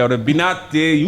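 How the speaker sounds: ordinary talk, nothing marked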